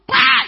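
sudden explosive "Pa!" shouted out loud; a loud shouted bang imitating a blast. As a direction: burst out with the blast sound. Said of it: "he just hear like a blast".

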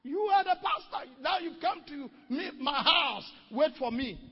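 high, loud furious shout at the pastor; angry shout. Retold or imitated. Husband shouts at pastor at his door, high furious yelling. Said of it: "He said".

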